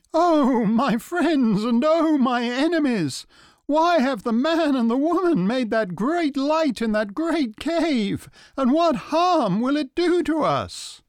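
Wild Horse voice. Acted. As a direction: call out in alarm as a horse. Wild Horse speaking in story.

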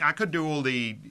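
ordinary talk, nothing marked

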